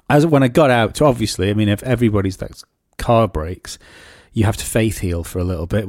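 ordinary talk, nothing marked

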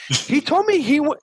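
ordinary delivery

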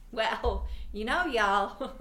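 slow put-on Texas drawl. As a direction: drawl it out like a Texan. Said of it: "You are not from Texas".

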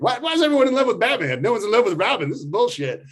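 high, fast petulant whining; whiny sidekick. Retold or imitated. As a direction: complain like a sulky kid brother. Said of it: "uh, like".

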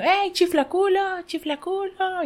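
high, slow drawn-out call across the street. Acted. Joke character calling to a man on the street, high drawn call. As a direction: hail an old schoolmate.

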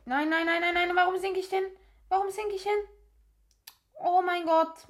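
frantic disbelief as the character sinks; panicked. Real, not acted. Character sinking by accident. (panisch und immer höher)

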